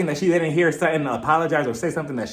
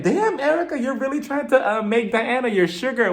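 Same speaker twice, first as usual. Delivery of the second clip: high, laughing tease at Erica; high teasing; 8 semitones higher than usual. Retold or imitated. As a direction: tease her, pitched high. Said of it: "And I'm like".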